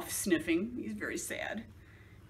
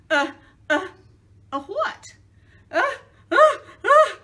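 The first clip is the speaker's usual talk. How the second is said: nervous stammer; 10 semitones higher, 11 dB louder than usual. Acted.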